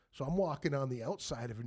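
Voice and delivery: ordinary delivery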